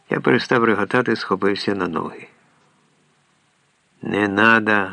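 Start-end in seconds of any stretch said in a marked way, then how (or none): none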